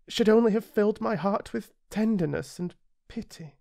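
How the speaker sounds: put-on high, wistful boy; looking back with regret; little prince confiding to the narrator. A narrator's reading.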